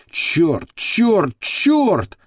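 repeated curse climbing in pitch; muttered cursing. A narrator's reading. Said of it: "бормотал серый кардинал". (бормочет с нарастающей досадой)

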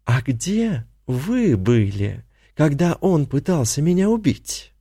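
slow, silky accusation; insinuating. A narrator's reading. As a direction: coax it out, barely holding back. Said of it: "вкрадчиво спросила Тина".

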